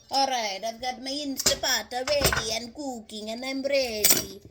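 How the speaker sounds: playful put-on Italian accent. Cook getting the carrot ready to cut, in an Italian accent. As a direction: play an Italian cook at work. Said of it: "Forgive my Italian accent".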